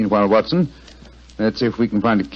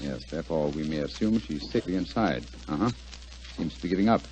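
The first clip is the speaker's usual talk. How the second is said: much softer, lower, calm and hushed; 7 semitones lower, 12 dB quieter than usual. Acted. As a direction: keep it low so he won't hear. Hiding in dark alley.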